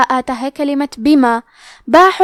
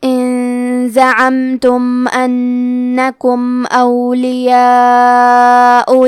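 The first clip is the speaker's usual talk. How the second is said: slow, melodic tajweed chant of the verse; Quranic recitation; 2 semitones lower, much slower than usual. Acted. Teacher reciting the whole verse for students, slow chant.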